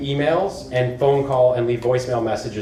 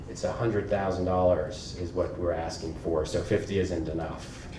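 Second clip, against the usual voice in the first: much softer and lower, coolly matter-of-fact; understated counteroffer; 6 semitones lower, 9 dB quieter than usual. Retold or imitated. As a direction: say it quietly as if it were obvious. Retelling her own bold counteroffer.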